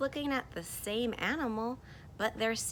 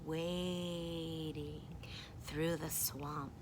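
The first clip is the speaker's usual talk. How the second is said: low, slow and softer; demonstrated wading; 3 semitones lower, 7 dB quieter, much slower than usual. Shown as a demonstration. Reader showing how you go through the swamp, slow and low. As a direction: draw out WADING slowly and low. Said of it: "Here's how you go".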